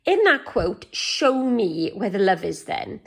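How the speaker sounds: normal talking voice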